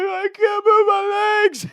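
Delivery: very high, slow, crying plea; boy crying as parents drag him; crying kid. Retold or imitated. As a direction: sob it out like a dragged child. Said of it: "He's like".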